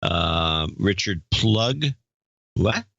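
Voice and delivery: normal talking voice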